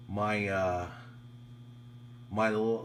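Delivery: ordinary talk, nothing marked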